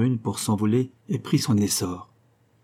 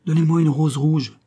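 higher, louder call to the rose tree; 6 semitones higher, 5 dB louder than usual. Acted. Nightingale begging the rose tree, calling out loud. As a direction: cry out the plea to the tree. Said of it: "lui cria-t-il".

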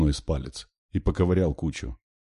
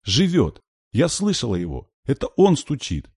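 put-on high, loud little-girl exclamations; high, emphatic child's voice; scared, insistent child; 8 semitones higher, 4 dB louder than usual. A narrator's reading. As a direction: insist urgently as a frightened small girl. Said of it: "испуганно и в то же время важно".

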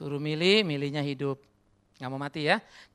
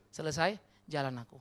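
ordinary talk, nothing marked

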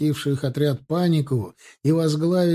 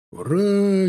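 high, drawn-out "hurrah"; lifted battle call; war cry; 5 semitones higher than usual. A narrator's reading. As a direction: raise a charge cheer. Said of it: "неслось уже с улицы".

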